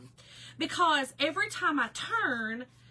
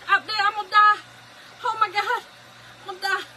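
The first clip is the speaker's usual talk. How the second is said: high, loud "I'm gonna die" through laughter; mock terror while laughing; laughing panic; 10 semitones higher, 12 dB louder, faster than usual.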